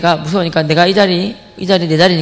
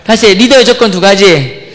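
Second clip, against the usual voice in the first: 리더의 조건 두 가지 slow and louder; louder repeat; 5 dB louder, slower than usual. Shown as a demonstration. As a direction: say it again, louder, for the class. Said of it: "따라해보세요".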